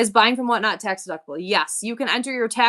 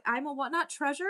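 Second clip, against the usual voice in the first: ordinary talk, nothing marked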